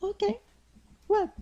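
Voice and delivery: high, thin ancient voice; frail tiny elder asking; very old faint voice. Acted. GM voices Shiwa.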